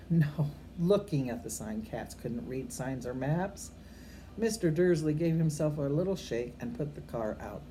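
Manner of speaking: usual speaking voice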